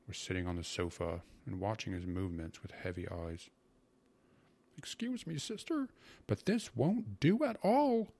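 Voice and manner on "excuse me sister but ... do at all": high-pitched, put-upon grumble; grumbling uncle. A narrator's reading. Uncle objects to his sister's hospitality, grumbling. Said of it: "my uncle grumbled".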